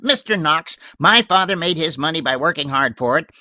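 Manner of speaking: put-on old lady shooing her maid off, tart and loud; shrill old lady. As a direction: bark it like a cranky old lady. Miss Letitia voiced.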